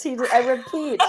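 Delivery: high laughing mock alert; mock alarm. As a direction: announce a code red, giggling.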